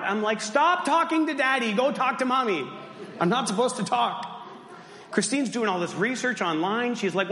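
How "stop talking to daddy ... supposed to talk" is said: acted high-pitched plea to the kids; exasperated dad. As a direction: play the fed-up father with no voice. Voiceless dad fed up with kids.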